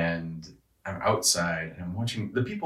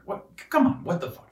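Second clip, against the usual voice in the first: Larry David confrontation; 12 semitones higher than usual. Retold or imitated. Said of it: "be the Larry David character".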